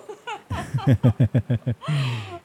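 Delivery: hearty falling laugh; laughter. Real, not acted. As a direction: let the laugh run out. Listener laughing at the story. (gargalhada solta)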